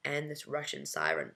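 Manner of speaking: ordinary delivery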